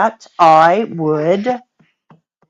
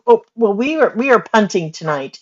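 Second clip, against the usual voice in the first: ordinary talk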